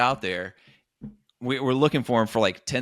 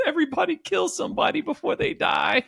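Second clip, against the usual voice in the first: very high cartoonish squeal; 4 dB louder than usual. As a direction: proclaim it as a crazed, squeaky cartoon. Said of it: "it's this cartoon voice".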